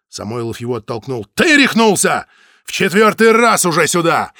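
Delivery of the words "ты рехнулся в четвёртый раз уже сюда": high, loud exasperated yelling; furious outburst. A narrator's reading. Samoylov rages at Zaytsev in the hallway, loud furious. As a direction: vent it all at him, fed up.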